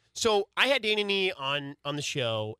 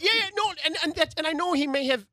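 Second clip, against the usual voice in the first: excited; 12 semitones higher, 3 dB louder, faster than usual. Real, not acted. Excited rush after interruption.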